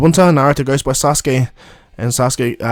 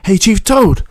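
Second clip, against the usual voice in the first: eager call; 8 semitones higher, slower than usual. Retold or imitated. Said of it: "Naruto goes".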